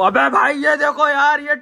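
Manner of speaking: high, loud call to look; loud exclamation. Real, not acted. Vlogger alerting friends to the greedy eater, high and loud. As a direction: cry out for everyone to see.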